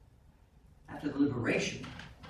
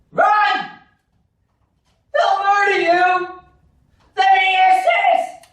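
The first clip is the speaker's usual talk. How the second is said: high full-volume shout of alarm; panicked yelling; 15 dB louder than usual. Acted. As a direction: scream a warning to fleeing troops. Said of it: "raw recruits yelling".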